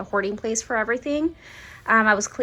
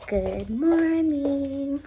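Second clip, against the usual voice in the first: very slow, drawn-out greeting; sing-song morning greeting; 3 semitones higher, 3 dB quieter, much slower than usual. Real, not acted. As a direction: stretch each word out.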